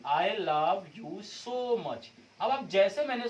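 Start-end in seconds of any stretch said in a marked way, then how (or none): 0.1-2.1 very slow tender I love you so much, so much stretched; loving, drawn out. Shown as a demonstration. Teacher shows the lover's I love you so much, slow and tender. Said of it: "अब यहीं पे मैं एक sentence दे दिया".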